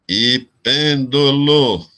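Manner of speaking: "pêndulo" slowly split into separate syllables. Shown as a demonstration. Teacher marking the syllables for students, syllable by syllable. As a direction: mark out each syllable of "pêndulo". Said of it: "eu vou até marcar aqui a sílaba".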